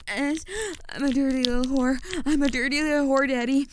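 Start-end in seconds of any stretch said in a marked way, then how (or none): 2.2-3.7 fast, louder rushed line; louder and faster. Shown as a demonstration. Told to say it faster, a rushed louder line. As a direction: rattle it off faster on command. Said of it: "say it louder and faster".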